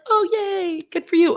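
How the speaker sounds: high, happy congratulations; genuinely excited. Shown as a demonstration. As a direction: cheer them on sincerely. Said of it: "Is it like".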